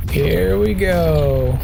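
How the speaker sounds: slow, stretched-out here we go; drawn-out here we go. Real, not acted. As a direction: stretch every word out, teasing the moment.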